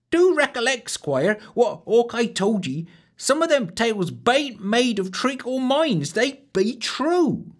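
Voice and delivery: put-on country girl's grave warning; warning wench. A narrator's reading. The wench warns the squire.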